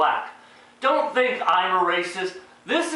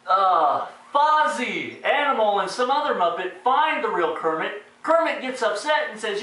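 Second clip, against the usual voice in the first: ordinary talk, nothing marked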